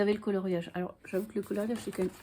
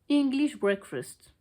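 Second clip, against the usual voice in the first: English accent, drawn out slowly and higher; showy English accent; 5 semitones higher, 5 dB louder, much slower than usual. Shown as a demonstration. Book vlogger showing off her accent on the tea name, showy English accent. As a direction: show off your best English accent. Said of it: "Admirez mon accent".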